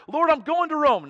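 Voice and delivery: high, loud, eager declaration; excited cry. Retold or imitated. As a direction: announce it like a thrilled believer. Voicing a hopeful believer.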